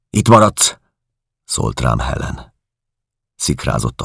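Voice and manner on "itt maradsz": loud, higher order; firm command. Acted. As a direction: plant him in place. Helen commanding the narrator stay.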